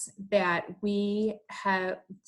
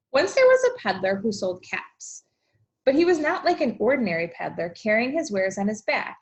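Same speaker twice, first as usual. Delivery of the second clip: storybook read-aloud to a class; lively picture-book narration; reading to children; 3 semitones higher, 4 dB louder than usual. A narrator's reading. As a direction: read it like story time for kids. Teacher reads picture book.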